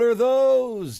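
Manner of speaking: high, loud, slow disbelief; shocked. Real, not acted. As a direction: gasp it out at the shoes. Shocked at platform shoes.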